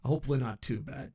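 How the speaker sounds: ordinary talk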